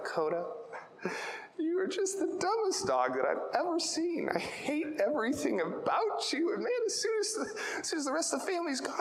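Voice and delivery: high, sing-song sweet voice with harsh words; sugary doggy talk, lightly laughing at the end; happy loving tone. Shown as a demonstration. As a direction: coo cruel words like baby talk to a pet. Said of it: "He's happy with me. He loves me".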